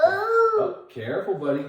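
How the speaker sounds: sudden sharp high warning; sharp playground warning. Real, not acted. Child about to slip or fall.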